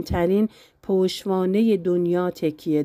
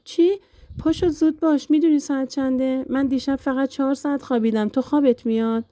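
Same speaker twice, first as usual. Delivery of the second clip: bright teasing wake-up call, higher; cheerfully rousing a sleepyhead, higher pitched; lively and playful; 6 semitones higher than usual. Acted. Husband teasing his sleepy wife awake at noon: lively, playful. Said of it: "با صدایی سرحال و شوخ گفت".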